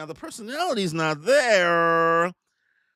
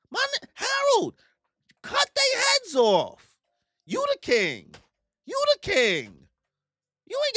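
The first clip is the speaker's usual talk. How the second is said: squeaky hype man. Retold or imitated. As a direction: egg him on in a silly high voice. Egging Harold on.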